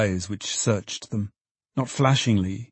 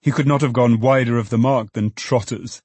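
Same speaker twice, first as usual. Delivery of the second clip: ordinary delivery, nothing marked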